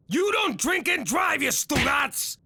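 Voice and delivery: preachy shout. Acted. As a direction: bark it like a public warning. Lecturing kid like a PSA.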